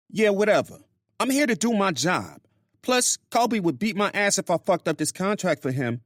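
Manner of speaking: high-pitched woman venting to a friend; Grace's voice. Acted. Narrator voices Grace on phone.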